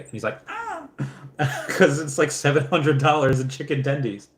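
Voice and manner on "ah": very high, soft strained "ah"; mock nervous squeal. Friend hears the tendies bill, high mock-anxious squeal. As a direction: imitate a tiny squeal of alarm. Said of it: "like, really nervous".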